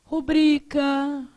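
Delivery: "Rubrica" very slow, stressed on BRI; careful prosody reading. Shown as a demonstration. Teacher reading prosody examples to the class, slow middle-syllable stress. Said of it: "lê isso aqui pra mim".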